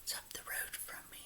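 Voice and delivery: a breathy whisper tossed off to the side; unvoiced whisper, confiding; whispered aside. Real, not acted. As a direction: lean in and whisper it like a secret. Whispered aside about location.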